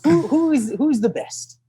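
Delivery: high, halting put-on question; imitated naive runner. Runner asking his coach about rivals, imitated high and naive.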